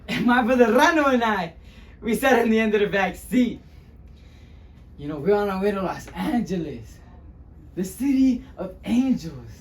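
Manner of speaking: dramatic first-person storyteller; animated monologue with weighty pauses; Luis Rodriguez. Acted. Luis recalling his childhood to the audience, dramatic and animated.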